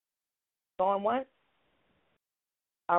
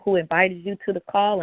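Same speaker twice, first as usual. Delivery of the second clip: ordinary delivery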